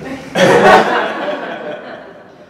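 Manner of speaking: loud airy laugh; real laugh. Real, not acted. Laughing back at her friend's scolding, loud laugh. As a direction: laugh out at the line. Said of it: "Laughing at Beckett is never funny".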